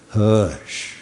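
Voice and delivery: low, soft Southern-style hush. Retold or imitated. Preacher voicing Jesus calming the storm, a soft hush. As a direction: hush it gently like calming a child. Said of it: "a good Southern translation".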